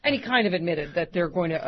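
normal talking voice

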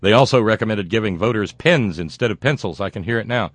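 ordinary talk, nothing marked

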